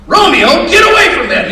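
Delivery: high, loud shout at a dog; acted yell. Owner catching dog drinking gasoline again, acted yelling.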